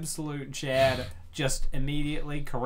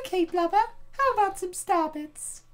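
high, sweet motherly voice; Rosalina voice. Acted. Rosalina confiding to readers about her favorite Lumas, high and sweet. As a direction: coo it like a doting space mother. Rosalina answers hungry Luma.

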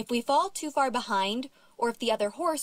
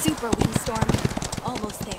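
urging horse on; 4 dB louder than usual. Acted.

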